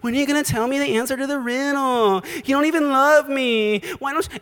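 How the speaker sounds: high whiny mock-crying nag; crying wife. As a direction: nag him in a high pretend whimper. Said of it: "she's coming to him and crying".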